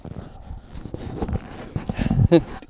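high, airy unvoiced laugh; breathy laugh. Real, not acted. Laughing with baby.

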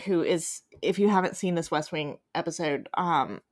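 ordinary talk, nothing marked

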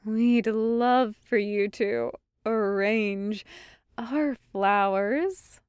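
slow, weighted words leading up to "flowers"; emphatic innuendo. A narrator's reading. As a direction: stretch "our flowers" out with heavy meaning. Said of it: "Marian said emphatically".

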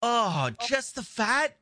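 high, drawn-out disgusted question; slow, high-pitched dismay; appalled disbelief. Real, not acted. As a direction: recoil in real disbelief.

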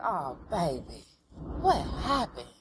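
a hint of a caring auntie; tender concern. Niecy gently asking Dana about the lashes, softly tender.

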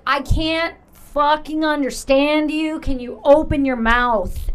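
loud, slow retold protest; exasperated outburst. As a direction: raise your voice and stretch each word in frustration. Retold exasperated reply to mumbling boss.

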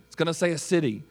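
usual speaking voice

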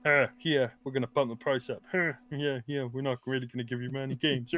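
lightly voiced smug executive; a hint of a smug corporate shrug; smug company voice. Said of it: "and then they're like".

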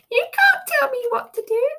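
put-on high, childish "you're not the boss of me" voice; defiant inner voice. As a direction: play a stubborn little brat inside your head, half laughing. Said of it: "that little voice in my head has gone".

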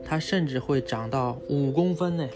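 normal talking voice

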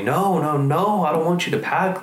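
high, pleading, close to tears; mom tearful plea. Retold or imitated. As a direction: beg him to stay, voice breaking. Mom crying, begs son stay.

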